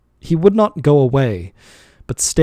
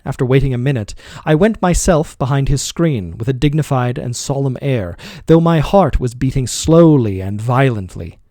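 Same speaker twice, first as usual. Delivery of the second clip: ordinary delivery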